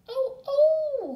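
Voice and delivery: high, loud wail of a wolf cub; howling. A narrator's reading. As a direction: howl it out high and long like a hurt cub. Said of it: "howled Smelly Breath".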